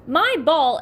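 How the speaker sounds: put-on loud, high child's scream. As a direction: scream it in dismay. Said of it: "Eddie screams".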